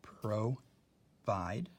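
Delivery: "provide" as pro, long pause, vide; broken into syllables. Shown as a demonstration. Teacher placing the long I in a syllable, split. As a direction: slowly say "provide" in two separate parts. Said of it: "in the second syllable".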